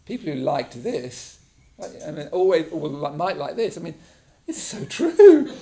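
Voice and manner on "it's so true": high, loud, slow laugh through the words; giggling in disbelief. Real, not acted. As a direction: laugh it out in real amusement. Laughing at accurate recommendations.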